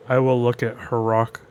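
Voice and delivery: usual speaking voice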